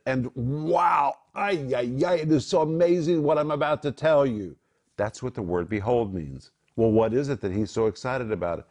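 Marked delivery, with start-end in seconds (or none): 0.1-4.6 amazed Jesus. Retold or imitated. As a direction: burst out in amazed delight. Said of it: "You could translate it".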